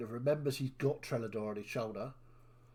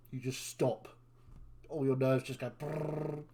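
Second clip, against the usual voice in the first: usual speaking voice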